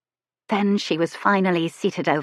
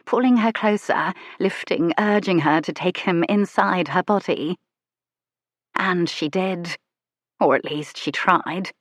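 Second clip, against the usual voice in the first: plain reading voice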